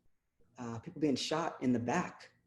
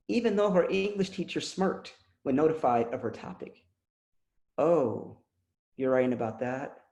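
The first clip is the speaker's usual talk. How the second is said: louder, declaimed spoken word, softening at the end; poem recital; 4 dB louder than usual. Acted. Poet reading a poem for her daughter Nia, proud spoken word. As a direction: read it with pride for a daughter.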